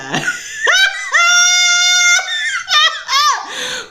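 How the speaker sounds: shrieking high cackle of real laughter; loud howling laugh. Real, not acted. Reader cracking up at a viewer's comment, howling laugh. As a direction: burst out laughing hard. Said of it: "She is funny as hell".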